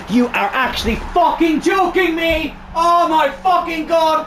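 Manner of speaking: high, furious rant at a striker; furious shouting. Real, not acted. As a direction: yell it out in rage. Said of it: "I had to get that out of my system".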